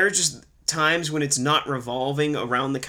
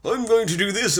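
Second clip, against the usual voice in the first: Bond villain; 7 semitones higher than usual. Retold or imitated. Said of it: "over-explaining like a fucking Bond villain".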